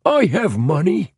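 high, whining addict's plea; desperate whine. A narrator's reading. Holmes assuring the dealer he can pay, desperate whine. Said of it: "whined Holmes in the desperate tones of an addict".

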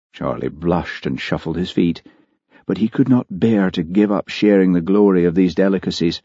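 plain reading voice

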